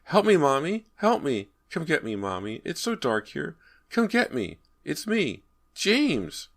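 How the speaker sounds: put-on high, pleading child's voice; a lost boy begging his mother to come; frightened little boy. A narrator's reading. Ghost boy calling from wall.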